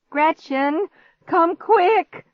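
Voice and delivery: high, urgent call for help; desperate cry. A narrator's reading. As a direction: shout for someone to hurry. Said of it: "his desperate cry from the kitchen".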